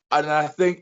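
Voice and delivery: normal talking voice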